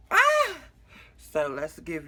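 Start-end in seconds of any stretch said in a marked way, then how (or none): 0.1-0.6 sudden high-pitched shriek; startled shriek. Real, not acted. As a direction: shriek out in surprise. Sudden shout before showing breast.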